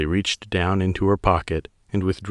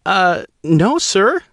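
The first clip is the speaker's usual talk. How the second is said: high, startled female pilot voice, louder; surprised; 13 semitones higher, 6 dB louder, slower than usual. Acted. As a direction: caught off guard, blurting it out. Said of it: "her voice surprised".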